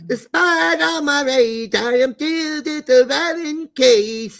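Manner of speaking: high, slow nasal rock singing. Shown as a demonstration. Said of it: "That's my best [name] I can do".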